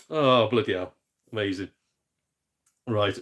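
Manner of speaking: ordinary talk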